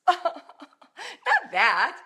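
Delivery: high, loud words through real laughter; amused at own parody; laughing. Real, not acted. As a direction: laugh it off while speaking.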